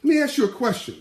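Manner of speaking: high, loud and bright mock praise; mock cheerful host. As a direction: gush a sarcastic great answer, pitched high. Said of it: "I said".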